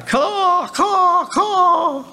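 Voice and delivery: high mock crow caws. Preacher mocking noisy crows with high caws.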